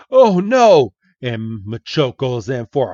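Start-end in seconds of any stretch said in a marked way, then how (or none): 0.1-0.9 high, loud yelp of panic; panicked cry. Acted. Red facing the charging Machoke, high panicked yelp. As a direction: cry out as the punch comes. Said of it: "And Red's like".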